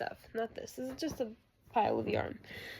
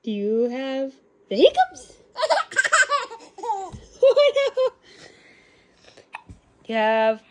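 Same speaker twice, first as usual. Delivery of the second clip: high, louder laughing coo; 8 dB louder than usual. Real, not acted. Mom teasing hiccuping baby.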